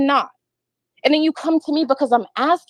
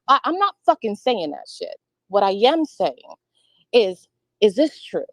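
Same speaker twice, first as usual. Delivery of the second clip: ordinary talk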